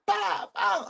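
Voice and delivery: high, shouted pop pop like gunfire; mock gunshot sounds. Streamer mimicking the gunfire while ranting, shouted pops. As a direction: blurt out the shots. Imitating gunshots mid-rant.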